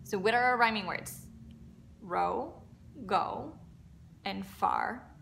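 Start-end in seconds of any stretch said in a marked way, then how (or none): none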